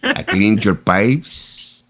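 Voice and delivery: put-on slow, drawn-out Mexican handyman accent; maintenance guy accent. Maintenance man telling Kimba about her pipes, slow Mexican accent. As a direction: stretch the words like a flirty Mexican handyman. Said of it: "with that voice".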